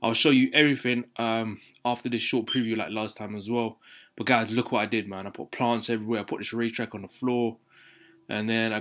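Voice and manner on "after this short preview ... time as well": ordinary delivery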